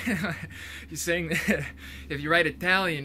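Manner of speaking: says it through a short laugh; chuckling as the thought lands. Real, not acted. Chuckling while explaining how Italian would be spelled.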